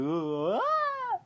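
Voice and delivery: rising "oh" into a high-pitched wail; voice changing. Retold or imitated. Dad's voice turning monstrous before Ben, climbing mock wail. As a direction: slide suddenly up into a very high cry. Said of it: "his voice starts changing".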